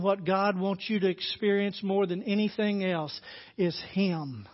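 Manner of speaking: ordinary talk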